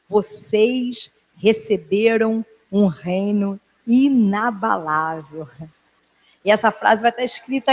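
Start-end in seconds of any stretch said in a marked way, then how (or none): 0.1-5.5 each word stretched out slowly; slow proclamation. Shown as a demonstration. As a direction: proclaim it slowly, letting it sink in. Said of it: "Essa é a palavra profética". (proclamação lenta e solene)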